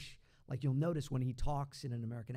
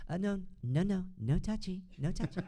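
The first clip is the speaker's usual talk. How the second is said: higher, fussy comic voice; David Spade; 6 semitones higher, 3 dB louder than usual. Shown as a demonstration. Voice double demonstrating a snarky comedian, higher fussy voice. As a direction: fend them off in a snooty high voice. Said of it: "Same with David Spade".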